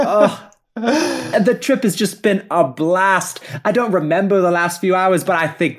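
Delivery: high starstruck chatter to Mister Bartleby; gushing Anne. Acted. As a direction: gush dreamily.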